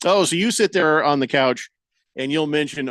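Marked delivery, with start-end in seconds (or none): none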